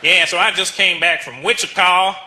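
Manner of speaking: slow, drawled Southern accent; thick put-on Southern drawl; deep southern accent. Shown as a demonstration. Indian person with the deepest southern accent, drawling. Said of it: "most southern accent in the world".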